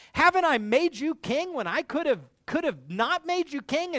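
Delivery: outraged, throw the questions at him; indignant outburst. Retold or imitated. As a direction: Abner furious at the king's accusation. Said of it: "And he says".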